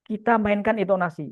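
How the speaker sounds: usual speaking voice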